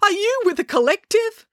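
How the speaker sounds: very high, panicky question; yelped. A narrator's reading. Dad suspects the unicorn is a kidnapper, yelping. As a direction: squeak it out in alarm. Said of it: "he yelped".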